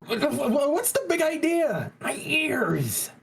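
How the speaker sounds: very high, indignant yelp; startled protest. Acted. Lawyer protesting at the bailiff's yelling, startled high voice. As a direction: squawk in alarm at being yelled at.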